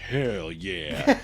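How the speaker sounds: low "Hell yeah" through a laugh; gleeful dad, half laughing; laughing mock cheer. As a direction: chuckle it out as the dad.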